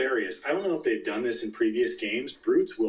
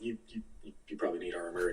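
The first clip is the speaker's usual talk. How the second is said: much softer dry remark; deadpan aside; 3 semitones lower, 10 dB quieter, much faster than usual. Retold or imitated. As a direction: drop it quietly, sarcastic. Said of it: "And I'm like".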